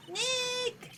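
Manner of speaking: high, drawn-out name call; high-pitched call. Acted. Acted annoyed calling to Nick.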